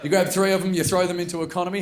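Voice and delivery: ordinary delivery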